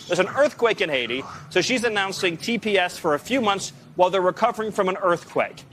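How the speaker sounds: ordinary talk, nothing marked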